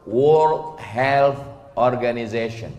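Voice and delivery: World Health Organization said slowly, th in Health clearly voiced; careful English. Shown as a demonstration. Preacher slowly sounds out the English name for the congregation. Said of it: "W H O".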